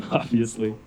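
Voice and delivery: usual speaking voice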